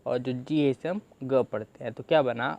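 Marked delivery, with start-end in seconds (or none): none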